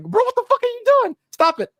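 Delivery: high squeaky mock exasperation; exasperated mock voice. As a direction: squeal it like a fed-up friend. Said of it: "It's like".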